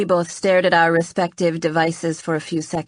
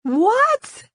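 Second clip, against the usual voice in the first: a sudden squeaky high "What?"; startled high-pitched yelp; high-pitched squeak; 4 dB louder than usual. A narrator's reading. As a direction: squeak it out, caught off guard. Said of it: "I asked in a high-pitched voice".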